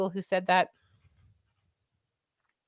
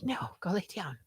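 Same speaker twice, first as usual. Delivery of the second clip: whispered shoo; 5 semitones higher, 5 dB quieter than usual. Retold or imitated. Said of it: "I'm like".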